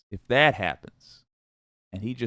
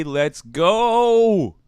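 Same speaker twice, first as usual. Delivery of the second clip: long, high, loud "go"; loud drawn-out hype shout; kids' hype cry; 9 semitones higher, 9 dB louder than usual. Acted. Quoting the kids' slang to viewers, mock hype shout. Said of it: "As the kids would say".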